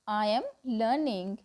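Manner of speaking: ordinary talk, nothing marked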